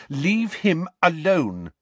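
high, slow, firm order; cold command. A narrator's reading. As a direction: coldly warn him off, drawing out each word. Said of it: "she said coldly".

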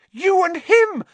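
high, very loud accusation; accusing shout. A narrator's reading. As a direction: hurl the accusation. Hermione accusing Lupin.

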